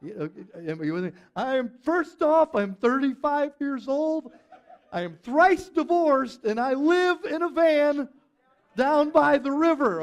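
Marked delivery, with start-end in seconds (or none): 1.3-10.0 loud, high, over-the-top motivational speaker; hammed-up, shouted self-introduction, pitched way up; Matt Foley impression. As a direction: belt it out like a blustering comedy-sketch speaker. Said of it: "this so reminds me of Saturday Night Live's Matt Foley".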